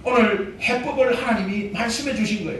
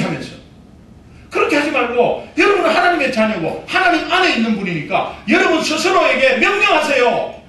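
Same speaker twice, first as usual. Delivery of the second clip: ordinary delivery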